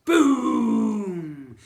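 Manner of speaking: huge loud explosive boom. Acted. Narrator sounding the bag bursting by the boy's ear, loud boom.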